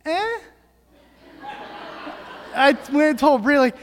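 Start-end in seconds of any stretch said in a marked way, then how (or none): 0.1-0.4 put-on small high questioning baby sound; imitated baby noise. Parent imitating baby daughter's noise with arms reached up, high and small. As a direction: mimic a baby's little high-pitched "eh". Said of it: "She goes".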